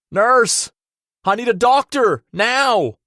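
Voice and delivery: high, loud urgent shout; frantic yell for help. A narrator's reading. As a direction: yell for the staff. Calling for help.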